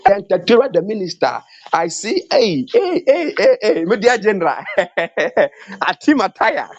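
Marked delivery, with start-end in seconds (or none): 2.3-4.6 excited greeting. Real, not acted.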